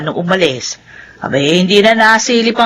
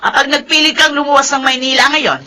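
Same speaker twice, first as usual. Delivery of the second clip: furious shouting mother; 6 semitones higher than usual. Acted. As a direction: shout the threat, no joking. Mother angrily threatens tearing papers. (galit na sigaw ng ina)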